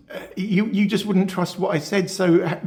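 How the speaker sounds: ordinary talk, nothing marked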